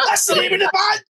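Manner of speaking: very high, incredulous retort; high-pitched mock outburst, near laughing; acted exasperated protest. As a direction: imitate someone spelling out the obvious, voice shooting right up. Acted exasperated reply to confused host.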